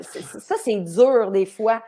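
usual speaking voice

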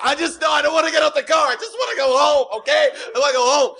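high whiny pleading; imitated sobbing begging to go home; mock crying plea. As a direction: wail like a panicked kid. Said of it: "je commence à pleurer comme une petite fille".

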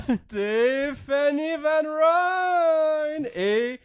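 high, slow, drawn-out host intro; sing-song welcome like a cheap show host; cheesy announcer voice. Retold or imitated. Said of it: "On dirait qu'on est au cirque".

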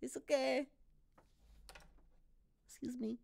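put-on very high, small voice with a Québécois accent; high, meek English, Québécois accent; French-accented English. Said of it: "j'ai un accent en anglais".

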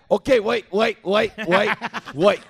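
calling after him. Retold or imitated. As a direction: shout after someone walking away. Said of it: "il me crie après".

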